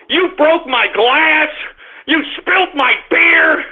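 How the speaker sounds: high, slow accusing scold; scolding the dog. Real, not acted. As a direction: spell out the damage to the guilty dog. Scolding dog for broken glass.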